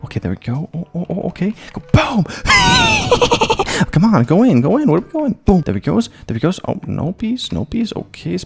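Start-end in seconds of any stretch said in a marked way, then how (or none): 2.3-3.8 high shouted burst of laughter; shrieking laugh. Real, not acted. Gamer's ball flies off, shrieking laugh. Wild shot in mini golf.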